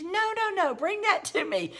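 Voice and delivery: high, insistent mock German-accented order; imitated German accent. Elderly German friend correcting her helper's towel folding, imitated German accent. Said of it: "say it in that heavy German accent".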